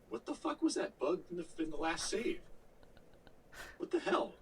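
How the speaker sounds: high-pitched disbelieving outburst, voice jumping up; incredulous outburst. Retold or imitated. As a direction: squeak out your outrage at the buggy game. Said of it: "I'm like".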